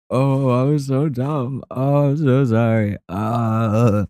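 slow, high apologetic whine; sorry kid. Retold or imitated. Kid apologizing to dad, high and slow. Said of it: "And I was like".

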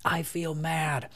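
put-on four-year-old son. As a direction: voice the young son naming his feelings. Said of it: "this kid at four, he can go".

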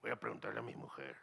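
low, soft, hurried reply; mock meekness. Big Sonoran deferring to his wife, comically meek. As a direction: shrink into a small deferential answer.

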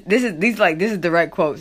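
ordinary talk

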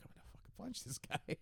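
hushed mock fury, barely voiced; under-the-breath threat; whispered thought. Voicing the guy's angry thought.

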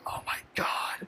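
slow, voiceless whisper; whispered mock shock. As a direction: whisper it slowly, aghast. Retold shock at bald spot.

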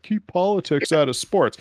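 mocking quote. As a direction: mimic whiny online complainers a little. Mocks fans' complaint in quote.

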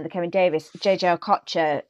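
ordinary delivery

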